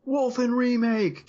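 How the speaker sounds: high, slow mock-excited exclamation; mock fan enthusiasm; caps lock shout. Shown as a demonstration. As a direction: read it like all caps. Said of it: "Caps lock, one exclamation point".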